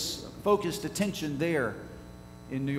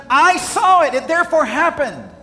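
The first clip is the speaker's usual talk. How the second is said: much higher, much louder declaration; defiant shout; 10 semitones higher, 12 dB louder than usual. Retold or imitated. Preacher urges congregation to answer deniers, defiant shout. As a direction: shout it out like a witness who won't be moved. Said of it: "Will you say to them".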